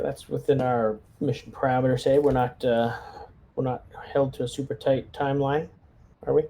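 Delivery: ordinary talk, nothing marked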